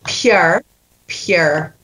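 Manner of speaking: "pure" said as "pehr", no "y" glide; Scots "pure". Shown as a demonstration. Coach modelling Scots "pure" for the actor. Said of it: "Yeah, very good".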